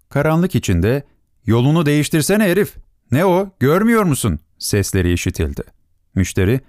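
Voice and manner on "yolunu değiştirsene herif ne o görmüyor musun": high-pitched indignant call from a passer-by; angry heckling. Acted. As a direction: challenge a clumsy driver, pitched up. Voices yell out of the darkness. (öfkeli, tiz sesle çıkışma)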